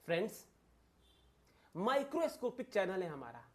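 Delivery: normal talking voice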